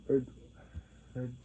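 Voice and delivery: ordinary talk, nothing marked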